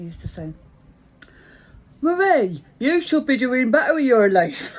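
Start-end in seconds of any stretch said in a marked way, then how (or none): 2.0-4.7 loud, higher mock London accent. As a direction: imitate a nagging Londoner, loudly. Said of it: "In the London accent".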